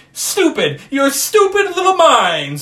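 high, booming alien shout. Retold or imitated. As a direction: yell it like a furious B-movie alien. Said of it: "the alien goes".

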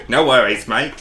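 mock Aussie. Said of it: "Or whatever they say".

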